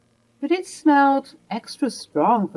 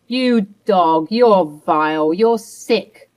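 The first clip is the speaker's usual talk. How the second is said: louder, spitting out each insult; furious accusation; 6 dB louder, slower than usual. Acted. Wife confronting her cheating husband, furious. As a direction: hurl the insults at him.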